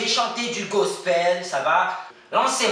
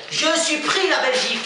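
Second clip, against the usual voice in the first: wild celebration; 9 semitones higher than usual. Acted. Contestant celebrates being selected.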